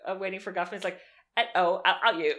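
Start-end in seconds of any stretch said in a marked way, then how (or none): 1.4-2.3 lightly mimicked Cockney; a hint of hammy Cockney; Cockney accent. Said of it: "With his Cockney accent".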